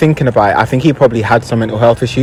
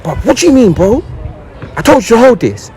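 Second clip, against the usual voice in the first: much higher, insistent, exasperated; pushy older boy; 11 semitones higher than usual. Retold or imitated. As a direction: demand it again, higher and annoyed.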